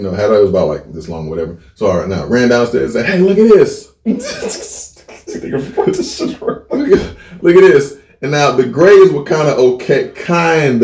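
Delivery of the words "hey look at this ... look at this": chuckling through it; laughing. Retold or imitated. Nephew to uncle, holding up the torn card, laughing. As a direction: laugh while showing it off.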